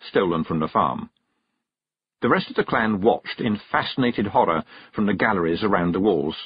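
ordinary delivery

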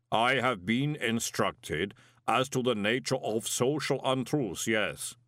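slow, measured inhuman voice; solemn golem. A narrator's reading. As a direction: deliver it word by word, like a clay giant.

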